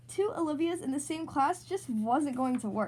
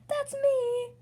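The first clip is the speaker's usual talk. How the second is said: family in chorus; 12 semitones higher, 4 dB louder than usual. A narrator's reading. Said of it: "the entire family replies".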